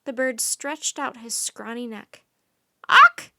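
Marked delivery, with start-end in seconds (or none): 2.9-3.3 very high, very loud squawk "Uck"; a baby raven's squawk; tiny croak. Acted. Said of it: "he croaked again".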